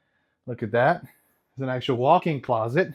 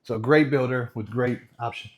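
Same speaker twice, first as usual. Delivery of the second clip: ordinary talk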